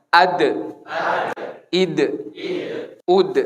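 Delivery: "Ad, id, ud" with a hard, T-like d at the end; Arabic dal drill. Shown as a demonstration. Teacher leads the class through the Arabic dal drill. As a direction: stop the breath sharply on each d. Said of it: "Jadi kayak huruf T persis".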